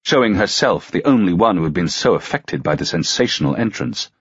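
ordinary delivery

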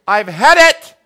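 loud, high exasperated shout; fed-up grandma yell. Retold or imitated. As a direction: blow up like a fed-up mom. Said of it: "your mom or your grandma say".

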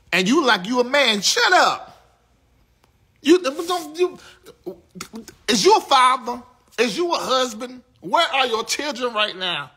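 shouting up high and loud at them; furious rant. Real, not acted. Chewing out the men in her chat, yelling high. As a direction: tear into him, voice way up.

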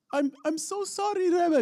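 high-pitched, hammed-up tearful child; crying little boy. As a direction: wail it like a sobbing little boy. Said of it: "and he was crying".

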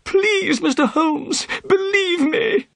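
very high, wailing plea; desperate cry. A narrator's reading. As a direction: beg to be believed in a frightened squeal. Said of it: "Thaddeus cried".

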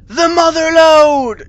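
high, loud, stretched-out cry; grand exclamation. Acted. Trick-or-treater finding a hoard of candy, awed shout. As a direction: belt it out slowly like a great discovery. Reading out the big candy find.

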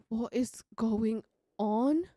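slow, drawn-out rising question; stunned slow question; slow disbelieving question. Real, not acted. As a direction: ask it aloud, stretched in disbelief. Same words repeated aloud.

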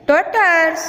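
ટોટર્સ high and deliberate; teacher's careful, high ટોટર્સ; English pronunciation demo. Shown as a demonstration. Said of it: "અંગ્રેજી ઉચ્ચાર થશે".

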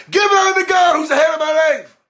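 high, loud, ringing chant; rote church testimony. Retold or imitated. Churchgoer says what tradition requires, high loud declaiming. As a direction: declaim the stock phrase in mock fervor. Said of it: "you have to say".

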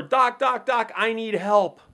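a mild mock panic at the door; desperate plea. Wounded man banging on door.

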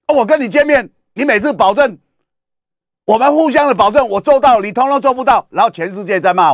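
high, loud aggrieved protest; voicing Xi Jinping. Xi Jinping to Biden about broken promises, high indignant protest. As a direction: complain bitterly that the other broke every promise. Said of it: "习近平不愿意跟拜登见面".

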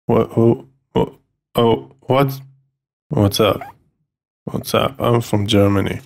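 low, quiet, dopey imitation; hushed, low mock greeting; mocked girlfriend. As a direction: mimic her underwhelmed intro, low and soft. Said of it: "and she's like".

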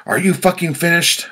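high, loud, exasperated shout; angry shout. A narrator's reading. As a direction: shout it as a furious demand. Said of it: "I shouted".

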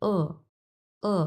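"ও" as an unrounded back vowel, not "এ"; a held "uh"-like "ও" with no rounding; spread-lip vowel. Shown as a demonstration. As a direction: say "ও" with lips spread, teeth showing. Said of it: "এরকম".

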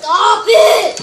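exasperated shout. Real, not acted. Player protests to opponent, exasperated shout. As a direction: yell at them to quit.

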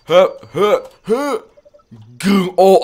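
high, loud mock huffing and growling; cartoon huffs and a growl; playful animal grunts. Playfully voicing panda reactions.

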